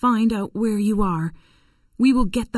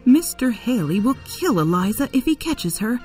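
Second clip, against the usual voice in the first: high, anxious voice; worried; 7 semitones higher than usual. Acted. Missus Shelby fears the slave trader will catch Eliza, anxious. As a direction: fret in a raised pitch.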